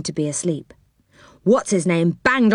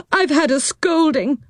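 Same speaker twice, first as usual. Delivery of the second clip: weeping; 8 semitones higher than usual. Acted. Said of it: "wept Moonface".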